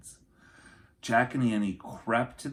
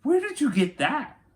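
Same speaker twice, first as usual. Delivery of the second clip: much higher and louder, amazed; surprised question; 8 dB louder than usual. Acted. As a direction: caught off guard by the coat. Jack surprised by Annie's coat.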